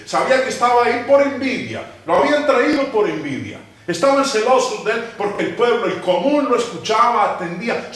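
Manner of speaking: high, loud, emphatic sermon delivery; fervent preaching. Acted. Pastor urging the congregation about Pilate, fervent preaching. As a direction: press each phrase on the congregation.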